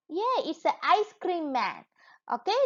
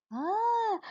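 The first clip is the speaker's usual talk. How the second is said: ordinary talk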